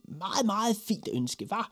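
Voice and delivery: high, squeezed, self-satisfied voice; smug little boy fishing for praise; boastful boy. A narrator's reading. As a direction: brag in a high pipe. Boy boasts again of his wish.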